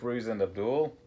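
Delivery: plain reading voice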